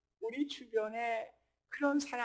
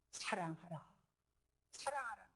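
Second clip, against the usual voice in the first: very soft after the climax; hushed exhortation; 3 semitones lower, 11 dB quieter than usual. Real, not acted. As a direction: drop right down to a quiet, gentle command. Soft repeated command after loud build.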